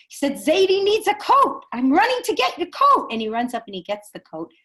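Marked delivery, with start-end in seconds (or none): 0.3-3.1 high, loud, insistent grandson; excited child's voice, retold at full volume; acted urgent insistence. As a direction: call it out while on an urgent errand. Grandson urgently answers father.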